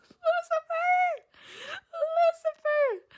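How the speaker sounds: very high, drawn-out soft squeal; fangirl squeal. Real, not acted. Reader gushes over Lucifer mid-story, high swooning squeal. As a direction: squeal the name out long, swooning.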